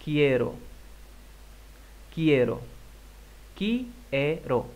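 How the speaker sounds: Quiero said as kiero, clear and deliberate; model pronunciation. Shown as a demonstration. Teacher models the word for students to repeat. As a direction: model Quiero slowly with a K sound. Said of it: "Repite por favor".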